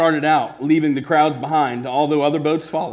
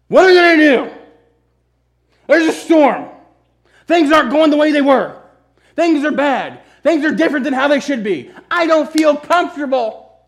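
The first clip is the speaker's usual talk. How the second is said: much higher, louder frantic disciple voice; mock panic; 12 semitones higher, 8 dB louder than usual. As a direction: play the disciples losing their heads. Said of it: "these disciples panic".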